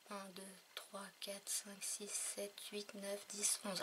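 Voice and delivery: counting softly under her breath, almost voiceless; tally them in a hushed whisper; murmured count to herself. Real, not acted.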